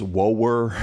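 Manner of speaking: "woer" stretched into two syllables, deep Southern; Southern drawl. Shown as a demonstration. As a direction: draw out "woer" slowly in a Southern accent. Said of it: "deep down in the South".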